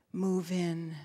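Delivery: ordinary talk, nothing marked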